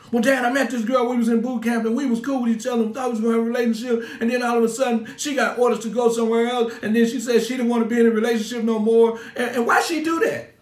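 high, loud, rushed son pouring out a breakup; heartbroken son. Retold or imitated. Son tells dad about breakup.

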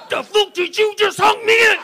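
high-pitched, loud aggressive shout; enraged confrontation. Acted. Steroided-up fighter to the driver who honked, enraged shout. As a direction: roar it in a put-on hard-man voice.

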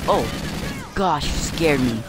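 slow, high startled gasp. Real, not acted. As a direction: shaken after a scare. Jump scare.

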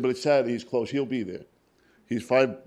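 hammered home as if arguing back; emphatic retold urging. Doctor overriding his Saturday objection, emphatic retold urging.

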